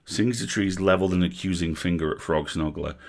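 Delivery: usual speaking voice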